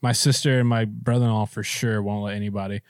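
normal talking voice

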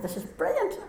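high, laughing glee; laughing with surprised joy; laughing delight. Retold or imitated. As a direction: giggle it out, thrilled. Delighted at clay brought out.

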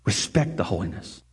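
low, soft reverent urging; hushed solemn. Real, not acted. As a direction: quietly press it home. Solemn closing exhortation.

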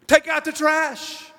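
rally the congregation; fervent pulpit shout; preaching at full force. Acted. Preaching command.

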